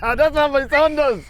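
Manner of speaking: high, loud, slow laughing. Real, not acted. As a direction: laugh it out teasingly. Whistling, laughing at cheating.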